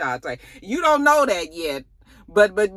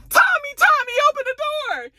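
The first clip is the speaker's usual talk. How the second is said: much higher woman calling through a door; frantic calling; 4 dB louder than usual. Retold or imitated. Maria calling Tommy through the door, much higher voice. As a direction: call out high and urgent.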